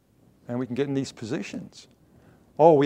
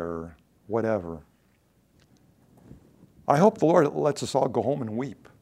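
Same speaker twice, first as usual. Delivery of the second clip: ordinary talk, nothing marked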